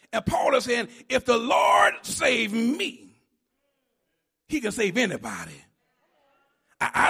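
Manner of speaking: loud, fast preacher's run; impassioned gospel preaching; full-force preaching. Acted. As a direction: drive it at the congregation.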